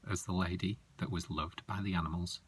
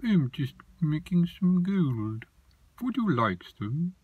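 funny creature voice; 9 semitones higher, 7 dB louder than usual. Acted. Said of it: "And he talked like this".

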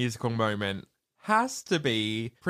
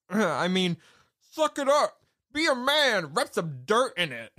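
mock macho correction; 9 semitones higher than usual. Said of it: "She's like".

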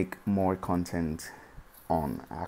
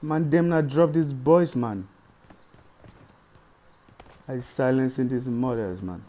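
put-on Dominican accent; 5 semitones higher, 7 dB louder than usual. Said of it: "we using the Dominican accent today".

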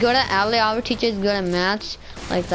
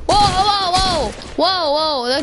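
panicking gamer; 6 semitones higher, 3 dB louder, slower than usual. Real, not acted. Ambushed mid-fight, yelling.

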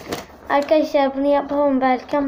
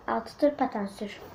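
softer murmured reread; quiet repeat; 3 semitones lower, 8 dB quieter than usual. Shown as a demonstration. Girl quietly rereading the dare she wrote. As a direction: repeat the dare softly to herself. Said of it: "şöyle yazdım arkadaşlar".

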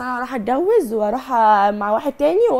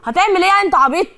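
higher, louder defiant yell; angry shout; 6 semitones higher, 5 dB louder than usual. Real, not acted. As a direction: shout him down in anger. Wife defiant in marital quarrel. (صراخ غاضب متحدٍّ)

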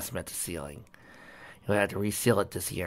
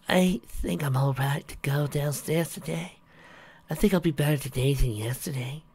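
thin voice; 6 semitones higher than usual. A narrator's reading. Weak wife reassuring her husband, thin voice. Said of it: "she answered in a thin voice".

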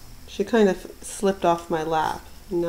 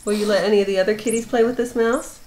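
pet talk. Real, not acted. Cooing at the cat.

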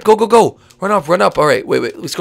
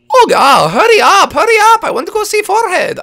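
excited shouting; 8 dB louder than usual. Acted. Urgent rush to go visit.

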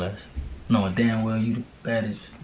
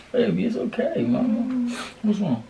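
much higher, tender coaxing voice; 11 semitones higher than usual. Real, not acted. Boyfriend soothing his crying girlfriend, in high tender baby talk.

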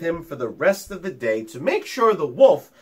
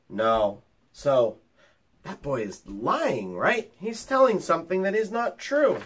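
ordinary delivery, nothing marked

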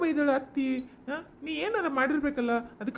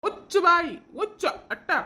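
angry outburst; 5 semitones higher, 4 dB louder, slower than usual. Acted. Husband scolds wife in quarrel.

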